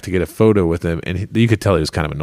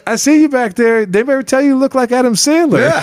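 much higher, easygoing voice imitating the comedian; tossing back a breezy thank-you, pitched up high; celebrity's thanks; 4 dB louder than usual. Comedian thanking a fan for the jacket compliment, high breezy imitation. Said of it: "He's like".

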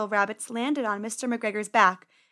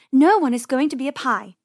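loud, insistent correction; firm, raised voice; firm sister; 3 semitones higher, 9 dB louder than usual. Acted. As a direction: put your foot down as big sister. Sister rabbit firmly correcting brother.

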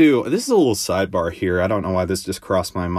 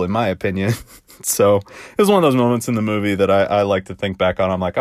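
ordinary delivery

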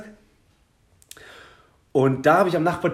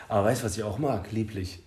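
mock connoisseur; 4 semitones lower, 6 dB quieter than usual. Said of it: "der so".

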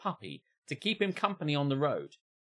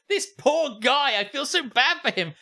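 mock pity; 9 semitones higher, 5 dB louder than usual. Real, not acted. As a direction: overdo the pity for the poor puppy. Pitying the puppy, raised voice.

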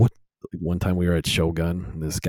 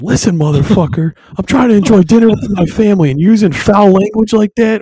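much higher, much louder furious shout; furious dad; 14 semitones higher, 13 dB louder than usual. Retold or imitated. Dad screaming at a swearing guy, furious high shout. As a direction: explode at him with full fury. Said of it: "my dad snaps".

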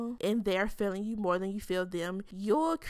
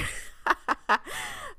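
tipsy high giggle. Real, not acted. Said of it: "I've been drinking".

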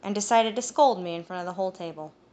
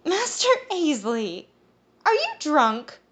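higher, teasing woman's voice; a giggly young woman, much higher, playfully scolding; amused woman; 9 semitones higher, 5 dB louder, slower than usual. Acted. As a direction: tease him sweetly. Woman amused by drunk man.

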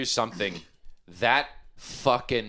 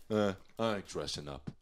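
mock grumpy guy; 7 semitones lower, 8 dB quieter than usual. Mocking a party guest.

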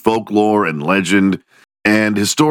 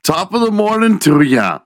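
hammed-up Irish lilt, pitched up high; a cheery stage-Irish greeting; mock Irish accent; 13 semitones higher than usual. Cheery mock Irish accent greeting viewers on Saint Patrick's Day.

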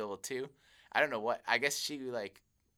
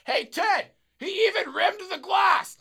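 very high, drawn-out shout; drunken holler; 10 dB louder, slower than usual. Retold or imitated. Drunk customer telling Ted about the rimmed glass, high hollering. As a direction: imitate a thrilled drunk yelling to his buddy.

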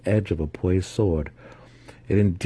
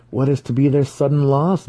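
prompting; 7 semitones higher, 4 dB louder than usual. A narrator's reading. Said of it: "Massage prompted".

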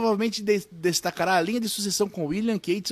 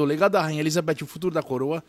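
ordinary talk, nothing marked

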